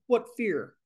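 ordinary talk, nothing marked

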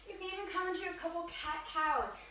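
ordinary delivery

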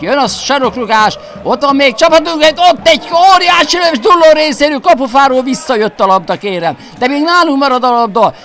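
rising, shouting play-by-play; excited hungarian sports commentary. Real, not acted. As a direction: call the near-goal at full voice. Commentator calls a big chance.

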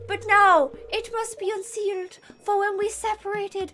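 light Irish lilt; Irish accent. Acted. Wizard asking for help, light Irish accent. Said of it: "Irish in different tones".

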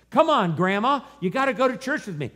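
acted-out granddaughter pleading, high and loud; mock insistent nagging. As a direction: urge grandma, pitched well up.

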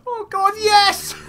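high, loud, slow ecstatic yes; triumphant cry. Real, not acted. Player overjoyed at the mobs, high ecstatic cry.

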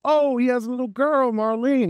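high-pitched softened-up character take; Barrett. Shown as a demonstration. Actor trying how a character sounds as a dad, high-pitched. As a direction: pitch it way up for talk of his little girl. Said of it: "He sounds different".

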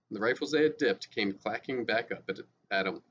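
ordinary delivery, nothing marked

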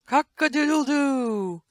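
high, slow cock-a-doodle-doo; drawn-out high rooster call; rooster crow. Acted.

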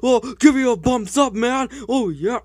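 loud, high celebration; excited whooping. Acted. Player celebrating the new character, excited cheering. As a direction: cheer it out loudly.

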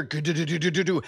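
rapid mouth-made rumble; stuttered tumbling sound effect; imitated falling clatter. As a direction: vocalise something clattering down a chimney. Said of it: "I hear".

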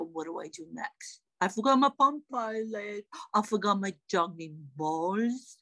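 low, plaintive put-on voice; a hapless partner pleading to be let in; Harry, the juggling partner. Harry asks wife to let him in from rain, low plaintive voice. Plays Harry left outside.

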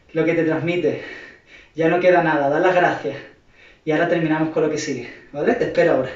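low, soft, reassuring voice; calm wind-down. Real, not acted. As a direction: ease them into a breather, low and soft. Calm wind-down before final part. (voz baja, suave y tranquilizadora)

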